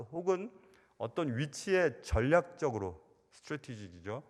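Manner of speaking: usual speaking voice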